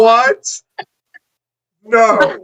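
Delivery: high, loud, incredulous; shocked disbelief. Real, not acted. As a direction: yelp it in disbelief. Reacting to absurd book plot.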